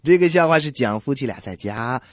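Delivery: normal talking voice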